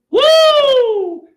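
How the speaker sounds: very high, loud whoop; sudden ecstatic whoop; excited whoop. Real, not acted. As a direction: let out a wild cheer. Sudden outburst.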